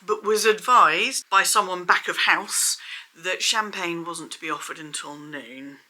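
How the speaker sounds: ordinary talk, nothing marked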